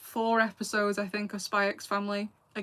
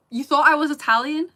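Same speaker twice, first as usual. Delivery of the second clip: loud, high incredulous question; real disbelief, pitched up and loud; 6 semitones higher, 12 dB louder than usual. Real, not acted. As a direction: blurt it out in astonishment. Reacts to viewer's guess of nationality.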